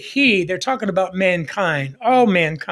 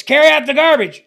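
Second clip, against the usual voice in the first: higher, louder command; a parent's raised call, higher and louder; 6 semitones higher, 5 dB louder, faster than usual. Retold or imitated. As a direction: raise your voice at the kid. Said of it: "So you raise your voice".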